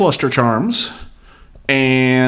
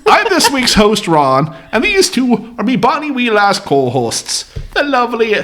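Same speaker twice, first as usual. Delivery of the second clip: hammed-up Irish brogue, higher; Irish accent; 7 semitones higher than usual. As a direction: lay on a bad Irish accent. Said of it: "Why am I using the shitty accent?".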